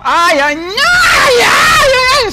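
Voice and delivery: high, loud drawn-out ai cries; real shrieks of pain while getting hit; pained yelps. Real, not acted. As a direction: yelp in pain, dragging it out. Hit by boss, screaming.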